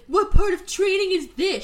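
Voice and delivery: high, loud bewildered cry; baffled Kovu. Retold or imitated. Kovu baffled during the play chase, in a high loud cry.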